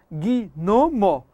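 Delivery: "gnomo" in three slow separate beats, gui, no, mo; "gnomo" as gui... no... mo, each beat stressed; wrong split in three. Shown as a demonstration. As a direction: break "gnomo" after every syllable. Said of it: "você não pode separar".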